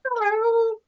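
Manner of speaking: squeaky high elf voice; christmas elf. Acted. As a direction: pipe it up like a tiny elf. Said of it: "my special little Santa's elf".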